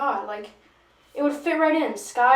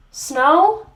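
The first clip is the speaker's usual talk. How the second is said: louder, higher incredulous question; escalated disbelief; 6 semitones higher, 9 dB louder than usual. Acted. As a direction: repeat it, utterly appalled and rising. Incredulous reaction, repeated and escalating.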